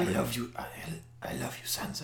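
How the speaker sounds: put-on creepy, near-whispered Irish-Welsh accent; Littlefinger's accent. Mocking Littlefinger wooing Sansa, creepy Irish-Welsh accent. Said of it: "What the hell is his accent?".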